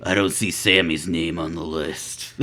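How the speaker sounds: low mock voice. Retold boss quote, low slow.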